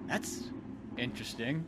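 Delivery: fading into a soft, stunned mumble; awkward shocked. Retold or imitated. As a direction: trail off in polite shock. Audrey in shock.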